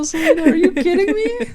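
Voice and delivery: high, loud, laughing disbelief; real laughing surprise at a friend; delighted disbelief. Real, not acted. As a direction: squeal it out, caught off guard and laughing. Friend calls out the segue.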